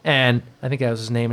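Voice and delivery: ordinary delivery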